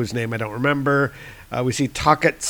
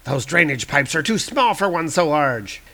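higher, loud startled villain; alarmed villain; 4 semitones higher, 3 dB louder than usual. Acted. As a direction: look around in shock. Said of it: "It's like".

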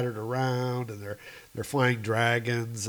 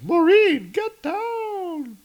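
Jane shouting; 5 dB louder, much slower than usual. Acted. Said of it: "Jane is like".

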